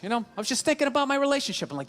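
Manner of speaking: normal talking voice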